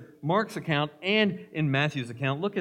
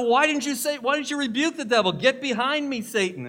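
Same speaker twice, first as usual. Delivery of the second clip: 1 indignant husband; 10 semitones higher, 5 dB louder than usual. Retold or imitated. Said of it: "And her husband said".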